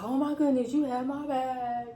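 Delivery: high, loud happy gasp of recognition; delighted regular. Retold or imitated. Regular customer greeting her favourite server, high and delighted. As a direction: voice a returning customer thrilled you remembered. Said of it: "they be like".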